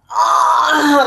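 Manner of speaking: high straining birth grunt, crying out; retold grunt. Retelling her labour, a loud straining grunt. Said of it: "I started grunting".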